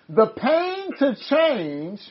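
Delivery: drawn-out words, writing them down; slow dictation. Real, not acted. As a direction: repeat it very slowly to catch it. Repeating slowly while writing it down.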